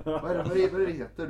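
laughing, searching for the word; giggling through the question; laughing while searching. Real, not acted. (skrattar medan hon letar efter ordet)

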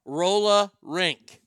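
very slow Boston "Roll a rink", word by word; drawn-out demo of Boston R-dropping; Boston accent. Shown as a demonstration. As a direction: drop the second R in "Roll a rink", Boston-style. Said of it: "they drop the second R".

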